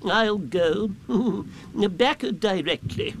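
high, slow owl voice; Owl agreeing. A narrator's reading. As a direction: reassure him as a ponderous owl.

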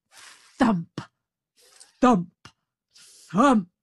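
booming footstep sounds; heavy thumps. A narrator's reading. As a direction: thump out the giant's steps. Giant's footsteps approaching.

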